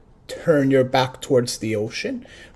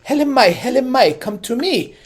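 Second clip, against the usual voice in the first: put-on higher, pleading call of a boy; 6 semitones higher, 4 dB louder than usual. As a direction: call the little shrimp to come, higher. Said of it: "He's saying".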